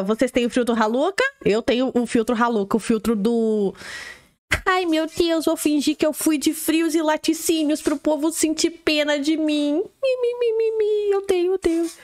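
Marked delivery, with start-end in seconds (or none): 4.7-11.9 high whiny pity-me voice; put-on high-pitched whining; mock self-pity. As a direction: whimper mockingly in a raised, babyish pitch. Mocking someone faking for pity.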